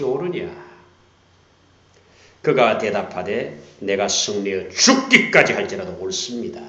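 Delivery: loud, forceful reading; preaching at full force. Acted. Preacher booming the verses at the congregation. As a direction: drive each phrase home.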